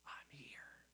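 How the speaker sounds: soft close whisper. Acted. God reminding Elijah he's not alone, tender whisper. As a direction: breathe it gently, right beside him.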